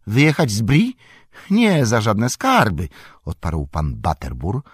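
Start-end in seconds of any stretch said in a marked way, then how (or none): 0.1-2.9 high, loud, panicky no; frightened refusal. Acted. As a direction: recoil in genuine fright. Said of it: "szczerze wystraszony".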